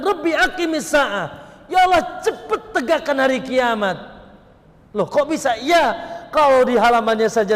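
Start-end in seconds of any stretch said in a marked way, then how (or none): 0.0-3.9 mild retold cry begging for the Last Day; retold cry to God. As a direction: call out to God, urgent but not a full scream. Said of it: "Maka dia teriak".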